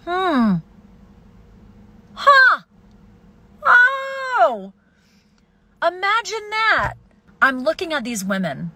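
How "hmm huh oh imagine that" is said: mock surprise. Sarcastic mock realization.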